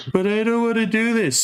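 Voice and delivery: high-pitched mock complaint; mock whine. Mimicking someone who won't work, whining high. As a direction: whine it like a reluctant student.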